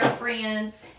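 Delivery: normal talking voice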